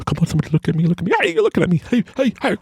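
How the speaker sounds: high, yappy puppy voice; eager little puppy. Acted. Little dog wanting someone to look, high and eager. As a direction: beg for attention like a puppy. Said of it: "I'm like that little puppy, little dog".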